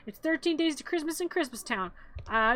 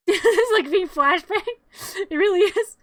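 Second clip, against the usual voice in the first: higher and louder, laughing through the words; 6 semitones higher, 7 dB louder than usual. Real, not acted. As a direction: crack up at the flashbang. Laughing at the bright scene.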